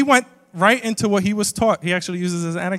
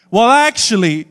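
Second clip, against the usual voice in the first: loud, higher-pitched pedantic interjection; know-it-all catchphrase; 5 semitones higher, 11 dB louder than usual. Acted. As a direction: butt in loudly with a correction. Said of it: "my catchphrase is".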